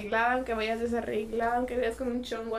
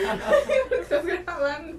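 laughing question; 5 semitones higher, 4 dB louder, much slower than usual. Real, not acted. Laughing friend asks why recording.

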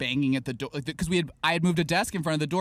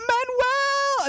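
very high, delighted call of a name; thrilled greeting. Retold or imitated. Teacher greets returning alumnus, thrilled high call. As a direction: sing out the name in happy surprise. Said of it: "she'd just be like".

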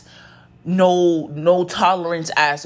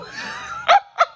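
teary laugh. Real, not acted. Overwhelmed thanking her haters.

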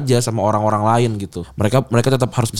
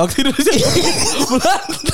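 laughing; 11 semitones higher than usual. Real, not acted. Cracks up mid-sentence.

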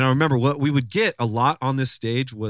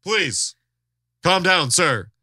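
higher, formal emcee plea, imitated; old radio announcer; 5 semitones higher, slower than usual. Stage manager calming two arguing men, imitated announcer voice. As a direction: appeal for calm like an old radio man. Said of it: "talked like this".

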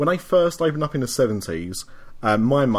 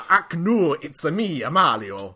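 hammy Italian Mario voice; exaggerated Italian Mario voice; 4 semitones higher, 5 dB louder, slower than usual. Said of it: "our new Mario".

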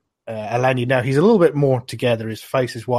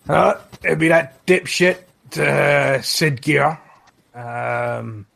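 scornful innkeeper; 4 semitones higher, 4 dB louder, slower than usual. Acted. Innkeeper scornful about Jarl.

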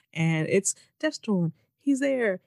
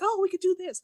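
much higher excited realization; mock eureka; 12 semitones higher than usual. Imitating the hero's breakthrough, high mock excitement. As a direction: squeak out the sudden idea. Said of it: "And he'd be like".

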